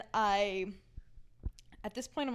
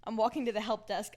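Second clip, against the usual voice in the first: ordinary talk, nothing marked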